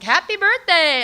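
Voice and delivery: high, loud birthday cheer; cheery husband. Retold or imitated. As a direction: sing out an over-bright morning greeting. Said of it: "cheerily sipping his coffee".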